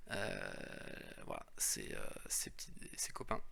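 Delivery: whispering. Real, not acted. Tender aside about cards.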